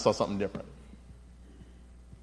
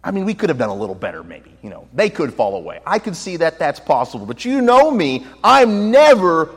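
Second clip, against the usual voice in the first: bold Peter; 3 semitones higher, 7 dB louder than usual. Retold or imitated. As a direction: play Peter boasting to his Lord. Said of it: "You can almost hear Peter saying".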